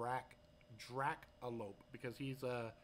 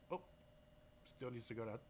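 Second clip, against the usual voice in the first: ordinary talk, nothing marked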